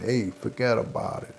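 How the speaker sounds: imitating a friend's Brooklyn Italian accent. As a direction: put on Brooklyn Italian wiseguy talk. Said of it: "Joey has the Brooklyn Italian accent".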